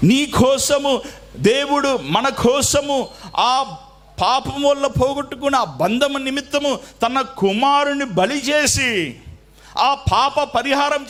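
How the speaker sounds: high, slow, ringing proclamation; preaching at full force. Acted. Pastor proclaiming to the congregation, high and slow. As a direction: lift it up high and slow for the church.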